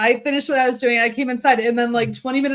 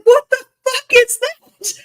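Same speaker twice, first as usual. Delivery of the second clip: high, slow, alarmed cry; a startled shout at a crash next door; startled yell; 12 semitones higher, 4 dB louder, slower than usual. Retold or imitated. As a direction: squeeze it out high and stretched, shocked at the noise. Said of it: "And I was like".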